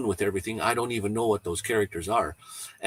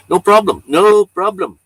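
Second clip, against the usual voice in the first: loud, high "no problem", repeated; real, booming forgiveness; emphatic reassurance; 9 semitones higher, 14 dB louder than usual. Real, not acted. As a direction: belt out a hearty brush-off of an apology. Answers a viewer's apology.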